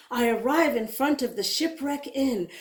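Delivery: usual speaking voice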